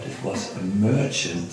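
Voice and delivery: usual speaking voice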